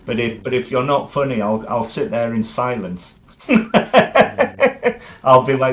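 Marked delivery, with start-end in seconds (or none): 3.2-5.2 high, loud laughter; long infectious burst of laughter; real laugh. Real, not acted. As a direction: crack up at your own confession. Laughs at own threat of silence.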